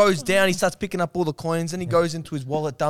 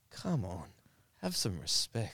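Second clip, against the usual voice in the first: much lower, much softer stern rebuke; stern stranger; 9 semitones lower, 16 dB quieter, slower than usual. Retold or imitated. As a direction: scold them low and quiet. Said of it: "he goes".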